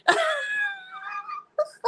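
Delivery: real high, helpless laugh; long squealing laugh, cracking up; dying laughing. Real, not acted. Laughing at their own lookalike story, dying laughing. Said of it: "I'm dying".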